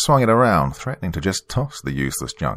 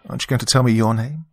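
quick, higher British tease; playful English lady, pitched up; British accent; 5 semitones higher, faster than usual. Acted. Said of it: "she had a British accent".

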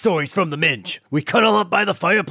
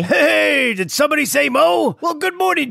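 excited; 6 semitones higher than usual. Acted. Mo barges in.

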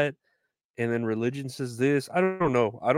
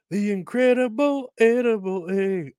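high announcer voice selling eggs; egg commercial jingle; 9 semitones higher than usual. Retold or imitated. Vlogger quoting the old egg commercial, high and bright. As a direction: pitch it like a TV ad slogan. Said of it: "pitch from that commercial about eggs".

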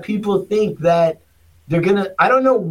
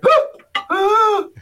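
imitating a big guy's squeaky, much higher "huh"; high-pitched voice; 12 semitones higher than usual. Mocking the nervous job candidate, in a high squeaky voice. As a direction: squeak it out like a timid giant. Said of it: "he had a high-pitched voice".

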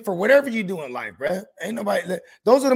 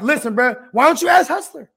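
louder, higher exasperated comeback; retold exasperated retort; 4 semitones higher, 7 dB louder than usual. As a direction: snap back at a pestering friend. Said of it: "I'm like".

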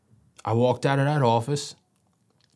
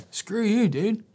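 defiant retort; 11 semitones higher, slower than usual. Retold or imitated. Thinking back at counselor.

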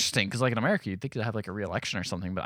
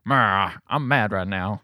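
slow, grumbling mock grump; Winston Churchill; 3 semitones lower, slower than usual. Churchill answering his wife, slow mock-grumpy voice. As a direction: grumble it lazily, badly faked bad temper. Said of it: "he's just getting grumpy".

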